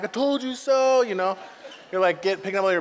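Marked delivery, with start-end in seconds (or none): 0.0-1.0 smug. Pretend. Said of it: "dropping the mic".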